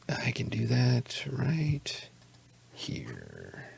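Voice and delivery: very soft, slow whisper; muttering to self. Real, not acted. Game master looking up loot, muttering to himself. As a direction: trail off into a near-silent whisper.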